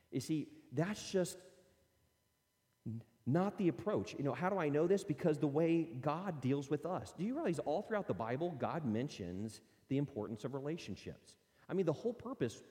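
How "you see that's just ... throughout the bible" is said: usual speaking voice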